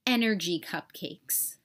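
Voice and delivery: ordinary delivery, nothing marked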